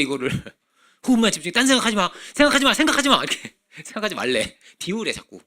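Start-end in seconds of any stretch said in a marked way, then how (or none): 1.1-3.2 fast, much higher and louder mock commands; mocking monk instructions. Preacher parodying monks telling people to empty their minds. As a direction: parody a monk's orders, rising and laughing. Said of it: "스님들이 열심히 하고 있는 거야".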